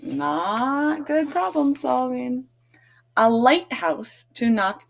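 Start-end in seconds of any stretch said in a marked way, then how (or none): 0.0-2.5 higher, slow scolding lady's voice. Acted. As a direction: gently tell Floyd off as the lady. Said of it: "The lady in here says".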